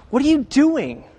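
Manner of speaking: sharp, high, snorting scoff; scoffing rebuke. Retold or imitated. Critics scoffing at the woman, a high harsh rebuke. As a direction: snort the question at her with disdain. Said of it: "angrily snorted at".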